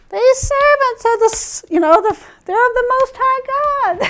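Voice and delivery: high put-on witchy voice; wicked witch. Slave girl following Paul, high mock witch voice. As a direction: call it out in a high, half-hearted witch voice. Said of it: "wicked witch thing that I usually do".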